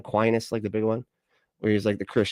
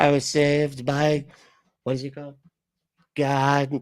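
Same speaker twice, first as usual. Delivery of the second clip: a light imitation of the president, slow and higher; a hint of the president's voice, higher and dragged out; mocking Trump imitation; 5 semitones higher than usual. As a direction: parrot the clip back slowly, mocking it. Mimics the Trump clip just played.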